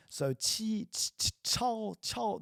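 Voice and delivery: chao said slow and high; qi and chao demo. Shown as a demonstration. Teacher contrasting qi and chao for students, slow and high. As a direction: draw out chao carefully. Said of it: "Try to say qi without the ao".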